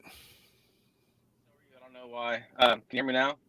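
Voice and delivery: faint, breaking-up call audio; bad phone line. Real, not acted. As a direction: voice fading in and out. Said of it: "You're, you're fading in and out".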